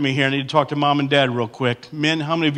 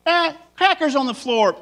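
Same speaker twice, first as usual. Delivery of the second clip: annoyed wife; 11 semitones higher than usual. Retold or imitated. Mock wife finding crackers.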